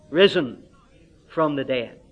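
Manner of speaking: loud, earnest pulpit appeal; fervent preaching appeal. Acted. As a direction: press the question on the congregation. Evangelistic appeal to hearers.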